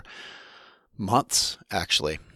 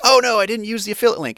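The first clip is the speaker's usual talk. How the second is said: higher, louder, hurried mock worry; mock dismay; 10 semitones higher, 5 dB louder, faster than usual. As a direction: play a listener suddenly realising a slip. Acting out a listener's worried thought.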